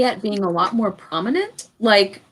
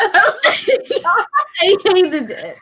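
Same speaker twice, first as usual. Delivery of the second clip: drawn out very slowly through a laugh; 9 semitones higher, much slower than usual. Real, not acted. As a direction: laugh your way through it in mock disgust. Laughing about the awful scene.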